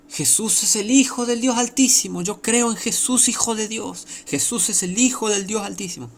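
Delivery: high, loud mock declaration of faith; acted high-pitched crying out; loud proclamation. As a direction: proclaim it like a showy false believer. Mimics loud professed believers.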